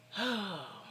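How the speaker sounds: long voiced sigh; weary sigh. Vlogger showing how she felt all year, weary sigh. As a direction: let out a worn-down sigh. Acting out weary feeling before quote.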